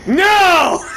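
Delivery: sudden loud high yell of dismay; real shout. Real, not acted. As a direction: burst out yelling as you get killed. Killed in game, yells out.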